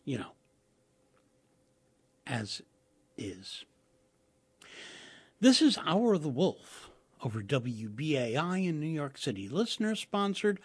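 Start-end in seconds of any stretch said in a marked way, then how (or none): 5.4-10.6 station ID read, a touch higher; radio announcer. Acted. Station identification.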